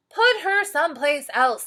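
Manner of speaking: loud, high angry shout; shouting team. Acted. Angry team yelling to put her elsewhere, loud shout. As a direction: yell it at the coach, fed up. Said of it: "they shouted".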